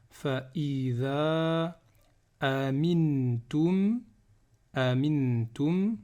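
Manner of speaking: very slow verse reading with held sounds; Quran recitation. Shown as a demonstration. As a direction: linger on nasals and long vowels.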